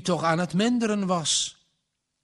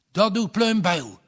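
higher, louder sudden call-out; exclaiming; 5 semitones higher, 5 dB louder than usual. A narrator's reading. Minister hears the bell and exclaims. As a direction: blurt it out at the bell. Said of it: "riep de dominee uit".

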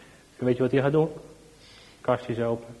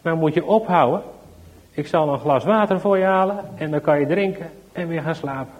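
louder, higher, mildly annoyed policeman; a bit cross; 4 semitones higher, 6 dB louder than usual. Acted. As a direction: lose patience a little with the boy. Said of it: "wordt zelfs een beetje boos".

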